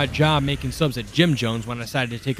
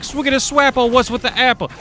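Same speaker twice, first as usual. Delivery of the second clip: much higher, louder swaggering rap-bro voice; wannabe rapper; 11 semitones higher, 5 dB louder than usual. Retold or imitated. Rapper's cousin walks up to the narrator, cocky high voice.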